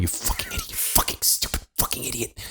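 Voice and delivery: whispered self-cursing under the breath; angry muttering. Retold or imitated. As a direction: hiss insults at yourself, barely voiced. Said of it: "like, muttering".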